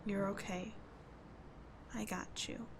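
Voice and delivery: tender hush. Acted. Friend holding a crying boy, tender and hushed. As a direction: hold him with your voice.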